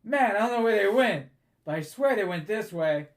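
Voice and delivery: high, loud, eager guard talking as he runs past. Acted. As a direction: shout that you saw them go this way. GM voices a passing guard.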